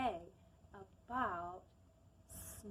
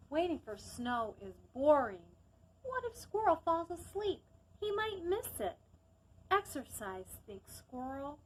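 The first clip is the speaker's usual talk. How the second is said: gentle storybook narration; calm storytime reading; reading a story aloud; 2 semitones higher, 4 dB louder than usual. Acted.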